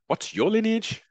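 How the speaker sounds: high, exaggerated mock interviewer; mocking Ariel. Host mocks Ariel's lineage question in a high parody voice.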